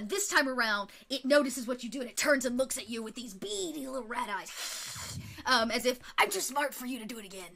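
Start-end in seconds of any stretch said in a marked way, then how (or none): 6.2-7.5 quick, lightly voiced pirate rat; a hint of a pirate, fast. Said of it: "I don't know why it talks like a pirate".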